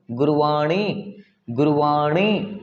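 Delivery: गुरवाणी slowly, retroflex ण each time; slow repeated गुरवाणी with ण. Shown as a demonstration. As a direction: drill गुरवाणी with a curled-back ण. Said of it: "गुरवाणी ना कि गुरवानी".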